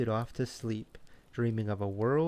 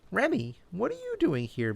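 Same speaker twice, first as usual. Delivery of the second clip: squirrel voice; 8 semitones higher, 3 dB louder than usual. A narrator's reading. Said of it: "Bella asked".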